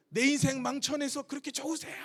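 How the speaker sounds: half-voiced cry of reproach; yelling at God, only lightly acted; mild mock yell. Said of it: "하고 소리를 질렀어요".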